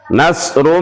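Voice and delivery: ordinary talk, nothing marked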